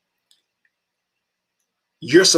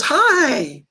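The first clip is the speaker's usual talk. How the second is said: high, light flirty voice of a stranger; mock flirty guy; 4 dB louder than usual. A stranger at the peanut store greets him, high flirty voice. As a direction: ham up a guy calling out from the bar.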